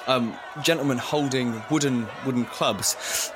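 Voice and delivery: usual speaking voice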